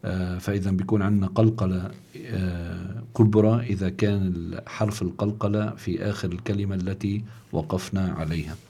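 ordinary delivery

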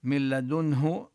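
من لدنه with the nun merged into the lam, no hum; merge without nasal. Shown as a demonstration. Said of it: "الإدغام بلا غنة".

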